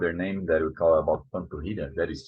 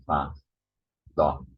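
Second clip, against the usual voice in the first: ordinary talk, nothing marked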